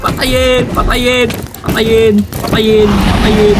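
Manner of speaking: high repeated battle cry, easing down in pitch; real urgent gamer yell; urgent calling out. Real, not acted. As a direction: call out the kill order over and over. Urging teammates to kill in game.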